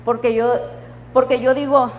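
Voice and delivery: usual speaking voice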